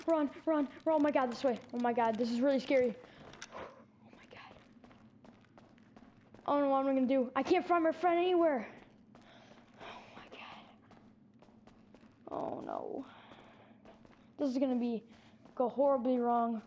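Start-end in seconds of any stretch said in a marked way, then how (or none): 4.0-4.5 hushed, barely audible whisper; scared whisper. Real, not acted. Scared, alone after the chase. 9.8-13.0 hushed, barely audible whisper; scared whisper. Real, not acted. Scared, cannot find friend.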